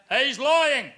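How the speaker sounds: high, loud accusing shout; heckler yell. Retold or imitated. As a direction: call him out from the back. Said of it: "my dad yells out".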